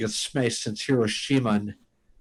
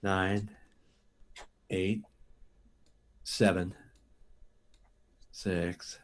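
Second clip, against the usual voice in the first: counting down, voice sinking lower and softer; each number quieter and lower until he's gone; fading into sleep; 4 semitones lower, 8 dB quieter, much slower than usual. Acted. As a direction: drift off under hypnosis as you count. Counting down into hypnosis.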